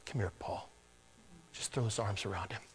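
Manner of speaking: quiet welcoming murmur; hushed and tender. Acted. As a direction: draw him in gently. Voicing tender embrace scene.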